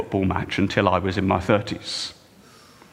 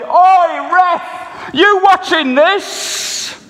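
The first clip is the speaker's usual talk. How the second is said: much higher, much louder bellow at the ref; football fan shout; 10 dB louder, much slower than usual. Retold or imitated. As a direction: yell across the stadium at the referee. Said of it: "cupped his hands around his mouth and shouted".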